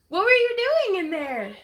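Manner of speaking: high, loud questioning call; loud sing-song query. Real, not acted. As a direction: call across the room, pitch way up. Calls out to someone off camera.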